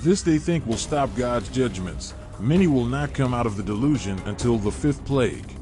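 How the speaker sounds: normal talking voice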